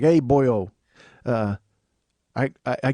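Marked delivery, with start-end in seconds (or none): 0.0-0.7 lightly imitated fake Irish accent; bad Irish accent. Host quoting their own failed Irish bit, mild mock-Irish accent. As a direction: replay the horrible Irish attempt at normal volume. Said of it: "a horrible non-Irish accent".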